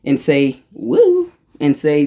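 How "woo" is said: mock spooky woo. Labelling energy mockingly.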